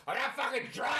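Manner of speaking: put-on high, yelled drunk denial; belligerent drunk. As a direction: slur it out like an angry drunk. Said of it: "belligerent drunks that are like".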